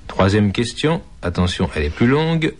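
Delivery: ordinary delivery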